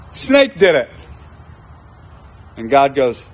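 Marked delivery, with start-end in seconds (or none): 0.1-0.9 lightly voiced Eve passing the blame; quick mock excuse from Eve. Eve blaming the snake to God, mock excuse. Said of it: "And then she goes".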